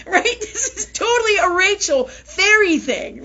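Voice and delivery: tickled by the thought of her friend; laughing. Real, not acted. Laughing about coworker.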